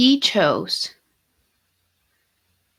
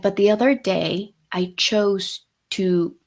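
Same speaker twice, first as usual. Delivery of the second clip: slowed right down, each word weighed; mock-solemn slow quote; dramatic slow retelling; slower than usual. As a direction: linger on it like a solemn line. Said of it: "he didn't say it like that".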